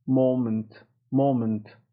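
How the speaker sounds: MOMENT with rounded American "oh"; American pronunciation. Shown as a demonstration. Teacher showing learners American مومنت, rounded "oh". American take after British one.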